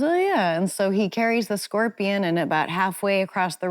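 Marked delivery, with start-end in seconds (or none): 0.0-0.6 convinced frog. Retold or imitated. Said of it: "And the frog goes".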